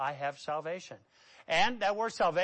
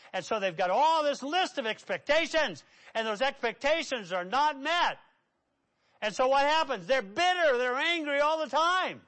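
high, emphatic pulpit delivery; full-force preaching; 8 semitones higher, 6 dB louder than usual. Acted. Preacher lists unmet expectations to congregation, emphatic preaching. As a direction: drive the point home, voice raised.

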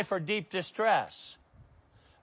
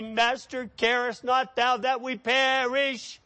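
high-pitched pleading; acted alarm; 6 semitones higher than usual. Disciples waking Jesus in a storm, mock alarm. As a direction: plead on a raised, sustained pitch.

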